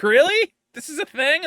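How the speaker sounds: loud, high incredulous Really; mock disbelief. Asking fans if leg colors matter, loud mock disbelief. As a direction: shout it in disbelief. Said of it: "I was like".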